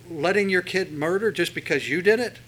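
ordinary delivery

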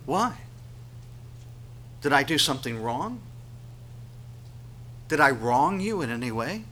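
plaintive questions lifting at the end; puzzled, pleading appeal; hurt questioning. Acted. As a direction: ask as the wronged loved one. God's wounded questions, voiced by preacher.

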